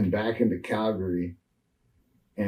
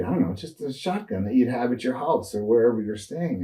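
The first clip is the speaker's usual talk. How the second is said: usual speaking voice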